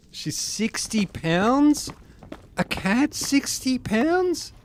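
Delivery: high, slow, drawn-out disbelief; incredulous. Real, not acted. Reacting to a sixty-pound cat, high and incredulous. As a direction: stretch out the shock at the number.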